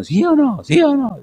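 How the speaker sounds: high, punchy preacher's call; preacher on a cassette. Retold or imitated. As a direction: imitate a preacher demanding an answer from the crowd. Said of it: "Y oigo ahí uno".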